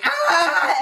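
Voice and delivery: short, loud, high startled scream; sudden high shriek; high scream of fright. Acted. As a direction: yelp in terror. Sees the monster.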